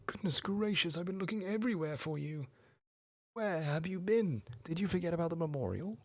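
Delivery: high-pitched teacher demanding answers; fussy scolding teacher. Acted. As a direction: flap at them like a flustered teacher. Scalar voiced, scolding.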